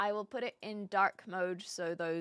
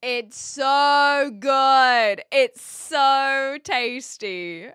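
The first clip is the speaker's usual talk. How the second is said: slow, loud, stretched-out praise; drawn-out rave; 4 semitones higher, 10 dB louder, much slower than usual. Real, not acted. Streamer raving to chat about butter chicken pie, slow and loud. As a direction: draw it out loud like a food rave.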